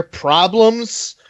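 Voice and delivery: ordinary delivery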